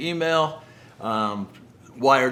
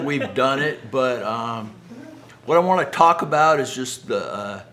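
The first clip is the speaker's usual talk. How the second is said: ordinary delivery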